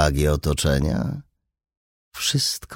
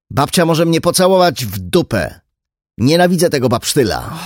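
louder, higher spiteful outburst; angry retort; 10 semitones higher, 6 dB louder than usual. Acted. Daughter snapping at her mother about grandma, loud and spiteful. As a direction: spit it through the door.

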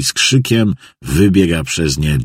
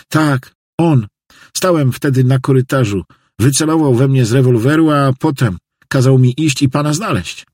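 high voice, recounting events to the sheriff; agitated janitor witness; 8 semitones higher than usual. Acted. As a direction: testify anxiously, pitched up. (przejęty świadek, wysokim głosem)

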